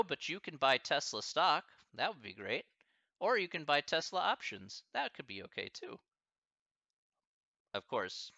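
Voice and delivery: ordinary talk, nothing marked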